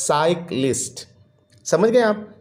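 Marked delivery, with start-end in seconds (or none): 0.1-1.0 cyclist in two beats, cyc / list; split into syllables. Shown as a demonstration. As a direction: break cyclist after the first syllable. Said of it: "has two syllables".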